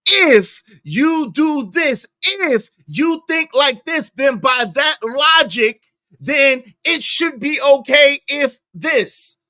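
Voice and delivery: drawn-out mock-patient explanation; slow emphatic paraphrase. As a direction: lay it out word by word like explaining to a child. Paraphrasing Tommy's logic slowly.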